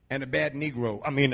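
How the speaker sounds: usual speaking voice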